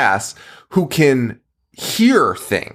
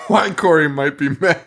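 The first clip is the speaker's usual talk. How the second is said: normal talking voice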